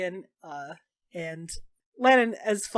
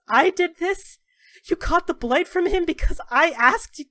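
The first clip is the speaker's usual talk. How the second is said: higher, louder, stricken cry; horrified disbelief; 8 semitones higher, 5 dB louder than usual. Acted. Dorian realizing his friend caught the blight for him, horrified cry. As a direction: recoil in guilt and horror.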